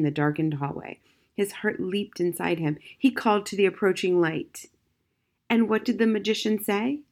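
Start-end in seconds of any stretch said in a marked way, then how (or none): none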